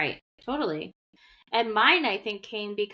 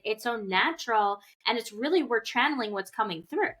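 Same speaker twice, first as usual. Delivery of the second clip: ordinary talk, nothing marked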